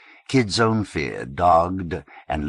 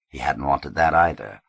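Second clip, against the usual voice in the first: usual speaking voice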